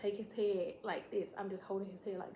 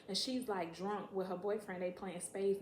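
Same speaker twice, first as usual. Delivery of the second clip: ordinary talk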